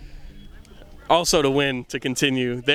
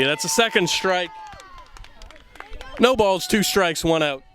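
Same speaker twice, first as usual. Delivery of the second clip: higher-pitched strike call; live play-by-play; 6 semitones higher than usual. Real, not acted. Commentator calling a second strike, live play-by-play. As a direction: announce the count with a raised pitch.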